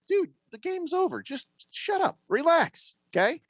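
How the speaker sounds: high mocking ref telling the coach to relax; dismissive ref. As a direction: brush the old coach off, pitched high.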